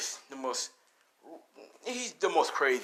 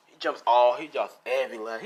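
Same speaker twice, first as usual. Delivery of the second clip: ordinary delivery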